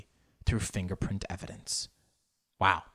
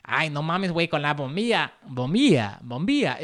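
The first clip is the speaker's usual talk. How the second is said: higher, playful Mexican accent; exaggerated Mexican Spanish intonation; 4 semitones higher, 4 dB louder than usual. Shown as a demonstration. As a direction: put on a sing-song Mexican accent. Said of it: "do a Mexican accent with bombilla".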